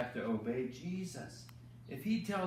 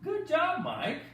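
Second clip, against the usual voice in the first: big praise; 9 semitones higher than usual. Pretend. Host cheering puppet Mike after his reading, high excited praise. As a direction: burst out with delight for the kids.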